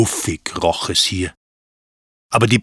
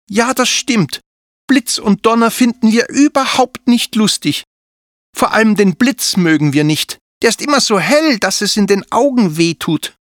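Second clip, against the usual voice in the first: put-on higher, childlike admitting voice; little girl dragon Zilli; 11 semitones higher, 3 dB louder than usual. A narrator's reading. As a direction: confess shyly that lightning scares you.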